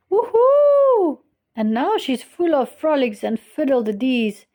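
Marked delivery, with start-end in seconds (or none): 0.1-1.2 high, loud woohoo; old lady's gleeful shriek; joyful whoop. Acted. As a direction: whoop with delight. Lady celebrates.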